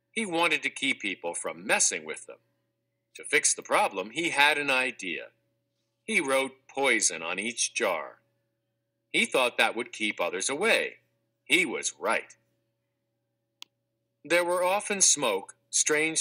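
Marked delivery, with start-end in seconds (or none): none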